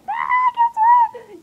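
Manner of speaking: very high, loud shriek; re-enacted excited squeal. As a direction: squeal big news to best friends. Acts phoning best friends with news.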